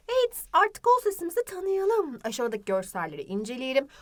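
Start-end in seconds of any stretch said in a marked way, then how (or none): none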